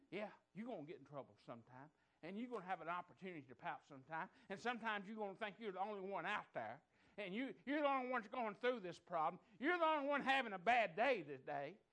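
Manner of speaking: climbing from steady to shouted preaching; building preaching. Real, not acted. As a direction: drive the sermon up to a roar. Preaching builds to full force.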